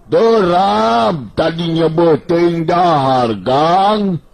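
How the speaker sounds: put-on high, slow, drawn-out voice of a tired young fellow; Banteng Bulung voice. Banteng Bulung grumbles to Rawing about hunger, high and drawn-out. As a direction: stretch the words out high and slow, complaining. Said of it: "Si Banteng Bulung ngareret ka si Rawing bari nyarita".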